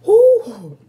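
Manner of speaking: sudden loud high ooh; a burst of surprise at the spice; real ooh at the heat. Real, not acted. Eating spicy food on camera, a loud surprised ooh.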